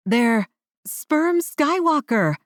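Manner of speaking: high, slow victory call; triumphant cry. A narrator's reading. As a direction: proclaim it like a victory cheer. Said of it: "cried out Dia".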